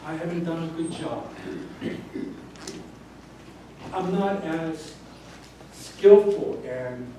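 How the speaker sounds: soft, hushed admission, partly whispered; confessing weakness. Retold or imitated. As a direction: quietly own up to your wife. Admitting weakness to wife.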